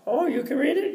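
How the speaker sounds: very high questioning cleaning lady. Acted. Cleaning lady questions the scholar about his reading, high voice. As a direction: imitate her in a high voice, rising at the end. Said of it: "she says".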